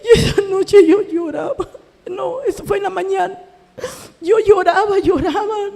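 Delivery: crying while telling. Real, not acted. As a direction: let the tears break through the words. Recalling weeping, still crying.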